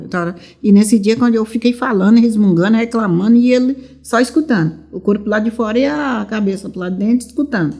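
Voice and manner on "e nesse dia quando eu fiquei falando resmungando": usual speaking voice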